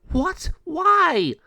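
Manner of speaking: high, loud shocked questions; dismayed. Acted. Mariana told she must do it alone, dismayed. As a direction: squeal in disbelief.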